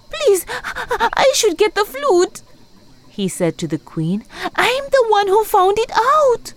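outraged protest; angry hyena. Acted. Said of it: "He was very angry".

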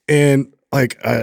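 normal talking voice

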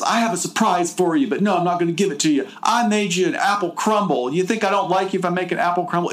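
high, rapid bad Southern accent; hammed-up Southern belle, fast and high; mock Southern heroine. Teasing the kidnapper, a fast high Southern accent. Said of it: "And she goes".

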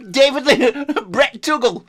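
high, loud character breaking into laughter; Brett Tuggle. Pretend. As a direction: keep the daft voice while cracking up. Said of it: "Brett Tu-, Tuggle's the name".

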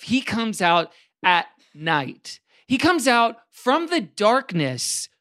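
slow, drawn-out sermon phrasing; slow preacher repetition. Pretend. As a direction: lean on each repeated line slowly. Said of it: "youth pastor impression".

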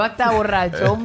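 "borrachón" drawn out slowly on the final O; slow mock quote, heavy accent on the O in "borrachón"; stress on final O. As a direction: stretch the "ón" of "borrachón", mimicking her. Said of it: "con un acento en la O".

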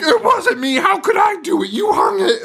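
put-on very high, frantic protest of a boy; mock screaming. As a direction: squeal your innocence like a panicked kid. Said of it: "everyone screams".

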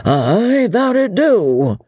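a hint of a jovial landlord; hearty old host. A narrator's reading. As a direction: landlord agreeing with his regular, drawn out. Host agreeing.

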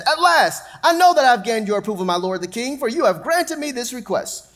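high, loud grateful burst; relieved gratitude. Acted. Joab bowing to the king, high and loudly grateful.

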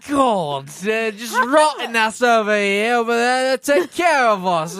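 slow, loud, emphatic teeth voice; loud mock teeth voice. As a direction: complain loudly as the teeth. Teeth voice continues.

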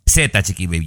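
ordinary delivery

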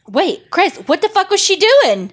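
very high, incredulous demand; worked up by a cliffhanger; agitated outcry. Real, not acted. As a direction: demand answers from the absent writer. Outraged at the cliffhanger.

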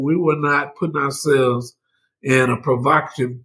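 usual speaking voice

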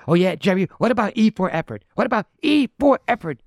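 high, loud imitated yell; mock screaming at Jerry. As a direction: yell it at Jerry like you're fed up. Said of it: "Larry starts screaming at Jerry".

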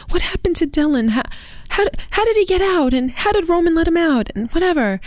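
high, rushed, upset questioning; frantic retold questions, high voice; distressed mother. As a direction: imitate a mother pleading for answers, pitched well up.

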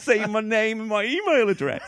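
high-pitched, through laughter; squeaking with amusement at yourself; laughing retelling. Real, not acted. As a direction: barely get it out for laughing. Retelling an embarrassing moment amid laughter.

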